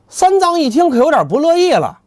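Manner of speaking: plain reading voice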